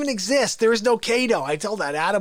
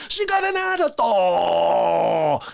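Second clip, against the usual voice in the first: slow, low, strained shout; Fauci excuse; 4 semitones lower, 3 dB louder, much slower than usual. Retold or imitated. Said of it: "as you said, said".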